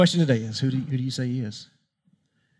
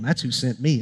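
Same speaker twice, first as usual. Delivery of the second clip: ordinary delivery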